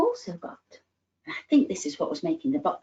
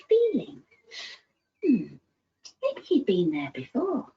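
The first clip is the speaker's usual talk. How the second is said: lively, animated storybook voice; reading to children; 4 semitones higher than usual. Acted. Storyteller reading George's adventure to little ones, animated. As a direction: tell it like a bedtime tale full of wonder.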